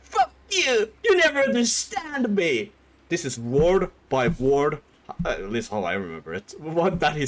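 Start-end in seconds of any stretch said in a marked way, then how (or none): 0.0-2.7 high, loud, sobbing whine; mock crying tantrum. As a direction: ham up a teen breaking into tears. Son's tantrum voiced.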